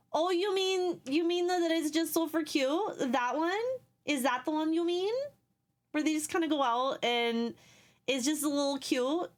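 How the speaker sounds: high, hammed-up Midwest accent; a playful put-on Midwest accent; silly Midwest accent. Shown as a demonstration. Jokingly showing off the Midwest accent to a chat friend. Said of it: "the Midwest misery accent".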